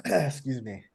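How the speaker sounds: throat still catching after choking; coughing up water. Real, not acted. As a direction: clear throat, then croak out the words. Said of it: "I was just drinking water and it went down the wrong pipe there".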